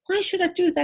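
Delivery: high-pitched balky retort; mock protest. Medium voicing Mickey told to go and find her, high mock protest. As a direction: imitate a boy objecting, pitched high.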